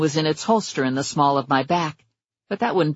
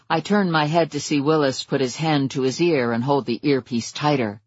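usual speaking voice

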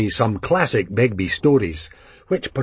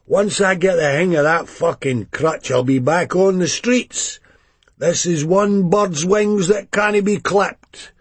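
exuberant junkie; 7 semitones higher, 3 dB louder than usual. A narrator's reading. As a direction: greet him with big bravado. Johnny voiced in the story.

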